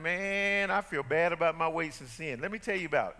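low, soft mock self-pity; mopey whiner. Pastor mocks believers dwelling on sin, low mopey whine. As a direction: play a sorry sulker moaning about sin. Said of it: "We're not sitting around saying".

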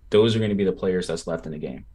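ordinary delivery

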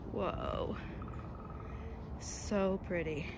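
soft, slow, quiet wonder; hushed awe. Real, not acted. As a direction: breathe it out in awe. Admiring the pond quietly.

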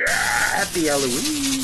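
slow high monster cry of Happy Halloween; monster shout. Pretend. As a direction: howl the greeting like a monster.

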